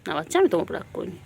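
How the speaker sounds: usual speaking voice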